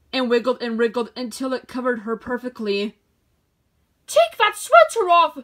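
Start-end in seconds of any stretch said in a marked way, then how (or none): 4.1-5.4 shouted, much higher and louder; shocked order. Acted. Shopkeeper orders the sheep to remove sweater, shocked shout. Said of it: "ordered the shocked shopkeeper".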